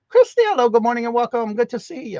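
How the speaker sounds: bright, high-pitched welcome, loud; excited high greeting. Real, not acted. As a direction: call out her name, high and loud. Greeting latecomer on live stream.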